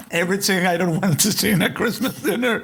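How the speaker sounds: laughing through the words; can't hold the laugh back while talking. Real, not acted. Laughing over the awful dinner scene.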